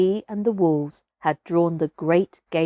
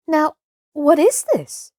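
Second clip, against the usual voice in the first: bewildered; 7 semitones higher, 3 dB louder than usual. Acted.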